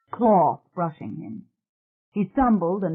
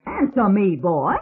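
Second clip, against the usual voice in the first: loud, higher bellowing command; bellowing nun; 3 semitones higher, 3 dB louder than usual. A narrator's reading. The nun bellowing at the boy she just struck. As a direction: bark it like a stern nun. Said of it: "That bellowing, coarse voice".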